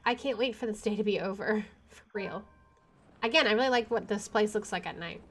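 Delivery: ordinary talk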